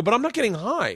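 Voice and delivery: high, incredulous protest; exasperated protest. Retold or imitated. Protesting to his wife, high and incredulous. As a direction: pitch shoots up in disbelief. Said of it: "I go".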